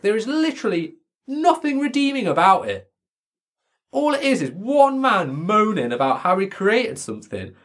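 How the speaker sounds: high-pitched exasperated ranting; fed up, complaining about the whole book. Real, not acted. Telling friend Frankenstein is terrible, high exasperated rant.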